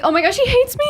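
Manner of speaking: high, panicky mock worry; imitating an anxious woman. A woman reading a curt text from a guy, in high mock panic. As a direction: squeak it out like she's sure he's upset. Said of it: "we'll be like".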